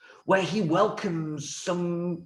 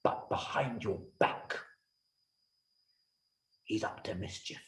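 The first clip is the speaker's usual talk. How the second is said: softer, hushed scheming minister; conspiratorial hush; 7 semitones lower, 10 dB quieter than usual. Acted. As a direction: drop to a secret murmur.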